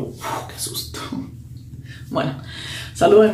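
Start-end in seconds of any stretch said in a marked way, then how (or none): 0.0-1.0 soft whispered sigh of relief; relieved whisper. Retold or imitated. Boyfriend relieved.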